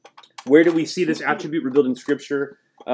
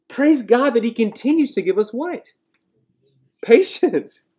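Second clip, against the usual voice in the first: ordinary talk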